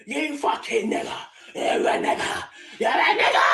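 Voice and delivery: half-laughing imitation of a drunk yelling; mock drunk yell. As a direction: act out the abuser shouting. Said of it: "I said".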